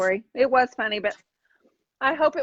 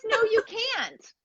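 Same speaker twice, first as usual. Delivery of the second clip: mocking incredulous quote; 9 semitones higher, much slower than usual. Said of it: "Who says".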